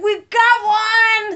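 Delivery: loud, high celebratory cry; acted victory shout; triumphant whoop. Acts out celebrating the plea.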